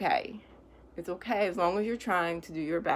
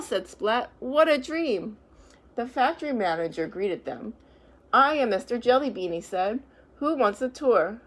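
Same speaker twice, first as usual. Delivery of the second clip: lively, louder storybook reading; reading to children; 3 semitones higher, 6 dB louder than usual. A narrator's reading. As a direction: read it out brightly to a class. Reads picture book to children.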